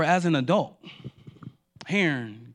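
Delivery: normal talking voice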